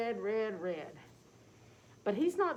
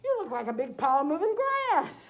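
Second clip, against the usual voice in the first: cow character voice; 7 semitones higher, 9 dB louder than usual. Acted. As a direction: hoot it out like a startled cow.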